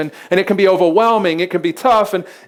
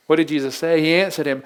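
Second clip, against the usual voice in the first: ordinary talk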